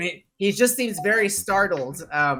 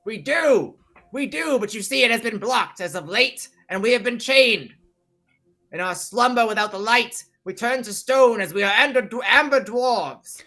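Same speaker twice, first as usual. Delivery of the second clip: high-pitched dwarf explaining his plight; raised, declaiming character voice; earnest dwarf; 7 semitones higher than usual. Acted. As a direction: explain the curse to the strangers in character.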